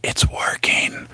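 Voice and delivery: mocking whisper of Joe; imitating Joe. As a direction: hush it out, slow and secretive. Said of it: "I heard Joe say".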